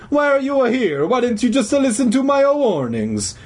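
put-on high, indignant Italian accent; huffy old Italian janitor; angry Italian voice. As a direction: protest in cartoon Italian. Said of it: "the man said angrily".

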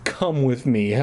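plain reading voice

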